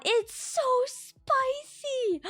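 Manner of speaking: high, very slow drawn-out whine; pained squeal. Acted. Streamer recalling the pepper's burn, squealing. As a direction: stretch it out like the burn still hurts.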